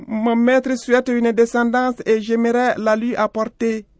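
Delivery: very high-pitched goat voice; high, earnest animal character; little goat. A narrator's reading. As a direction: plead like a devoted little goat. Narrator voices the goat pleading.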